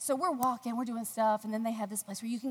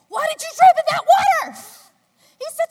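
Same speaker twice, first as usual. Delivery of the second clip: laughing mock shout; 18 dB louder than usual. Husband's alarmed question retold laughing.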